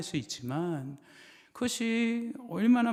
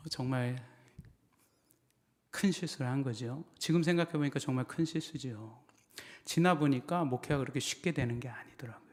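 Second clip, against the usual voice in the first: ordinary talk, nothing marked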